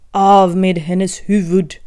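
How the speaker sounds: loud, slow furious royal order; angry shouting. Acted. Queen ordering Alice's head off, loud and furious. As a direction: bellow the sentence. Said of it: "ilsk och ropade".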